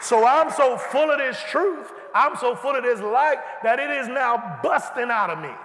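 fervent preaching shout; preaching at full force. Acted. Preacher telling the church the light bursts out, shouting. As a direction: let it burst out of you.

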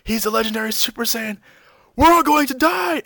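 hammed-up terror, loudest at end; panicked. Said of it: "and says".

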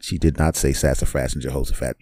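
normal talking voice

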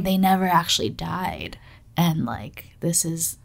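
hushed, voiceless whisper. Real, not acted. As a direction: whisper a spooky theory. Whispered conspiracy theory.

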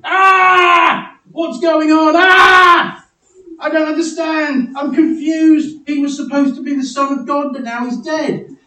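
high, loud screams of confusion; hammed-up panic. Preacher acting out bewildered disciples running around, hammed-up panic. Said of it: "ran around going".

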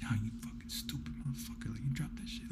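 whispered self-scolding; unvoiced inner voice. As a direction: hiss it like the voice in your head. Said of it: "little voice inside your head".

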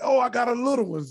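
loud, high taunt; trash talk. Retold or imitated. Player trash talking Danny Ainge, high and loud. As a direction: needle him loudly. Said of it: "I said".

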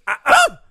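high, loud double 'uh'; imitated tennis grunt. Host correcting the coach with her grunt, loud imitated grunt. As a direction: punch out two high grunts. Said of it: "No, she was".

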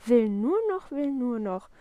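loud, mocking echo of the child; angry mimicking. A narrator's reading. Papa furiously apes Willi's favourite phrase, mimicking. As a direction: parrot the excuse back crossly. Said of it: "unheimlich zornig und äfft ihn sogar nach".

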